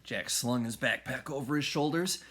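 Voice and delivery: plain reading voice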